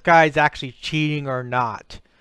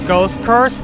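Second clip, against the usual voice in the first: raging gamer; 7 semitones higher, 7 dB louder than usual. Real, not acted. Taunting another player in-game.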